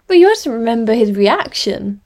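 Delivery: loud, eager insistence; loud enthusiasm. A narrator's reading. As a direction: push it with bright enthusiasm. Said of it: "her voice loud with enthusiasm".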